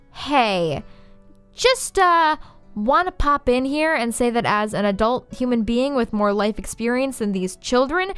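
plain reading voice

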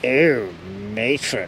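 low, soft mock-scandalised catchphrase; borrowed catchphrase. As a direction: drop it low and soft, hammed up. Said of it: "it's not my new saying, it's Christopher's".

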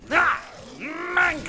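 high loud yell; frustrated shout. Real, not acted. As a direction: yell out as you die. Dying in game.

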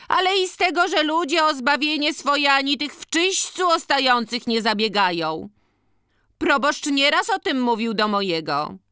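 very high-pitched matron scolding; organist's wife, reproachful. Acted. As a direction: complain piously, voice held way up high. (bardzo wysoki, karcący głos organiściny)

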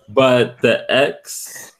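normal talking voice

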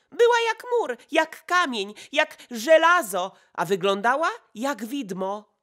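high, loud excited outburst; Idalka calling out. A narrator's reading. Idalka bursting in on Zdzisław, exclaiming loudly. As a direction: burst in exclaiming at the top of her voice. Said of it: "już wołając".

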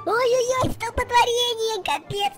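high, loud excited outcry. Real, not acted. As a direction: react to a sudden pileup. Pileup during race.

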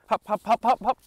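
high, loud, bouncy puppy calls; cheerful high-pitched hop hop to a puppy; excited hop calls. Real, not acted. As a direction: pump the dog up with quick high hops.

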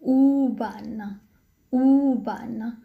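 "أوبان" with a long, held first u; long initial u. Shown as a demonstration. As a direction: draw out the opening "oo" of "أوبان". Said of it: "أول الكلمة تأتي طويلة". ("أوبان" مع إطالة حرف u في البداية)

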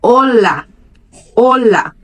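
"Olá" with stress on the first O; Spanish style "Olá", first syllable stressed. Shown as a demonstration. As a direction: lean on the O of "Olá". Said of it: "a ênfase é no o do olá".

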